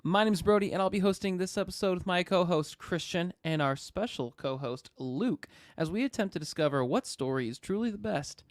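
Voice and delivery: show host intro. Acted. Podcast host opening intro.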